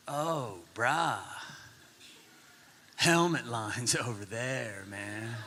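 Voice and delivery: put-on low, slow, unbothered rental kid; very relaxed slacker. Ski rental kid to a waiting dad, very relaxed. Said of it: "They're very relaxed, not much gets them upset".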